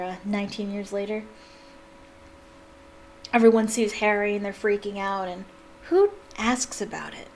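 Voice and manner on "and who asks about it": plain reading voice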